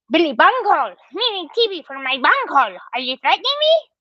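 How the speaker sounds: squeaky Beavis-style cartoon voice, hammed up; Beavis and Butthead voice. Host reading a chat comment in a Beavis and Butthead voice. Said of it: "Do you guys remember Beavis and Butthead?".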